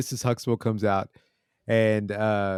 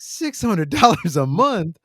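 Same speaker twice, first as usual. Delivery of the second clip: high, slow, drawn-out mock disbelief; Theo, shocked; 7 semitones higher, 3 dB louder, slower than usual. Narrator imitating Theo hearing the rent, shocked. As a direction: repeat it as a squeaky, incredulous teenager.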